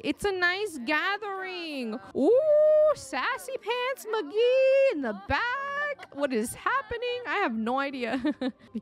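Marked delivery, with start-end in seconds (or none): 2.2-6.1 high, loud, slow drawn-out teasing call; excited. Real, not acted. Player spots sassy Sim in background, excited. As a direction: tease her like a delighted gossip.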